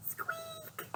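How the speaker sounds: tiny high whispered squeak; hushed high squeak. Acted. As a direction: breathe a little mouse squeak. Repeats mouse squeak.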